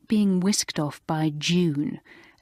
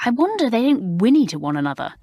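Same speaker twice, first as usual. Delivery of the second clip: exasperated; 6 semitones higher, 4 dB louder, faster than usual. Acted.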